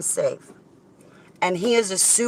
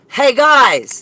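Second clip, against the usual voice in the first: ordinary delivery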